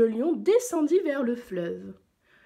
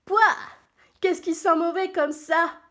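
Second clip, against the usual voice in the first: higher-pitched cry of disgust; disgusted giraffe; 9 semitones higher, 6 dB louder than usual. A narrator's reading. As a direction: recoil from a stink, as the giraffe. (girafe dégoûtée, voix aiguë)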